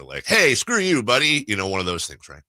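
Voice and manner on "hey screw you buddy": mock angry jab, higher and louder; pretending to be mad at a friend; fake mad. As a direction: playfully snap at a buddy. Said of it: "fake mad at each other".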